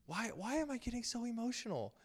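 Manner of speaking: high-pitched puzzled self-question; retold bewilderment, pitched well up; exasperated self-questioning. Questioning himself again during the film.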